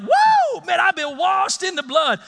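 high whoop into excited praise; happy whoop. Shown as a demonstration. Pastor showing how the happy congregation sounds, excited. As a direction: burst out with joy. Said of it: "and it's like".

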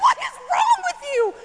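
high shocked outcry; incredulous shout. Retold or imitated. Husband to wife at the game, shouting in disbelief. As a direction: yell in disbelief. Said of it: "And he's like".

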